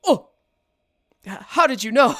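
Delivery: imitated caught-out "oh", then high, loud question; Foss. Imitating a friend cut off mid-sentence, caught-out reaction. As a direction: mimic being found out, ending in laughter. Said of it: "not going to forget the sound".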